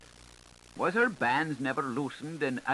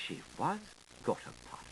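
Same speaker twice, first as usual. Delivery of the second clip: gossipy whisper; 5 semitones higher, 8 dB quieter, faster than usual. Acted. Washerwoman whispering gossip to her friend, hushed. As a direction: drop to a hushed whisper on the last words.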